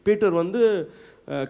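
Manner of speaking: ordinary talk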